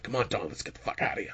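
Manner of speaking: whispered aside; hushed, hurried getaway whisper; imitating Kenny. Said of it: "He was just like".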